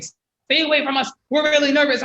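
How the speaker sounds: very high and loud, the frightened dog; a squeaky, loud, put-on scared dog; nervous dog voice. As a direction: voice the dog's pleading warning to stay away.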